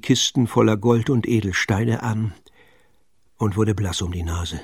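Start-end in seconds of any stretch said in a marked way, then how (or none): none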